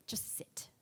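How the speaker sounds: hushed, near-whispered order; quiet command. Acted. Mother pointing at the bed, hushed order. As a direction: say it low-volume and final. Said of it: "So she quietly grabs the scissors".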